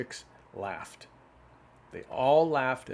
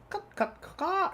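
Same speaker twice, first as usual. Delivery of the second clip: hen cackling. A narrator's reading. Hen mocking the cow, cackling hard. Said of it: "she cackled".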